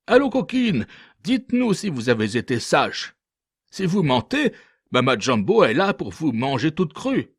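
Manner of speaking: high, teasing mock threat; husbands threatening wives. A narrator's reading. Husbands threaten their wives in story. (maris menaçants, voix haut perchée)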